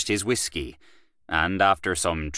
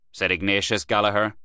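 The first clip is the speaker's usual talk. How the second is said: plain reading voice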